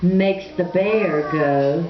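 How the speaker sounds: usual speaking voice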